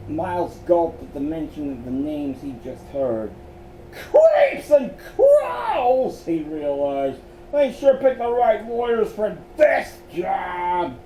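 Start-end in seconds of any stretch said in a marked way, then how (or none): 3.9-6.2 a high, slow wail of fright; panicked, drawn-out wail; frightened cry. Acted. Miles realizes in fright. 7.5-11.0 higher, louder frightened shout; scared shout. Acted. Miles scared comment.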